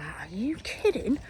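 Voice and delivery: hushed, slow are you kidding; whispered disbelief. Retold or imitated. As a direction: breathe it out in disbelief. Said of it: "I was like".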